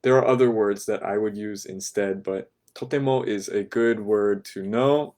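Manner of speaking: ordinary talk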